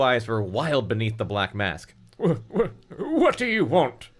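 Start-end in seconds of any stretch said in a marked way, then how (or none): 2.2-4.1 high, halting stammer; hammed-up scared boy; frightened stammer. A narrator's reading. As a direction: stutter into a panicked question. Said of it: "Brendan stammered".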